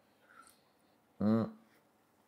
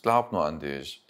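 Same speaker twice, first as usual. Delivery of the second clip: normal talking voice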